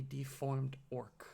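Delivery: ordinary delivery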